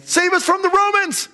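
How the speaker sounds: high-pitched rallying cry; crowd's cry. Acted. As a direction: call out high, like a crowd demanding rescue. Acted crowd's political cry.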